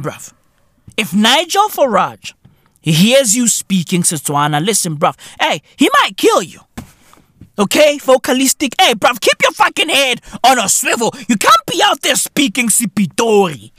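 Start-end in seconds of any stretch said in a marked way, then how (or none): none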